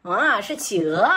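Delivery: ordinary delivery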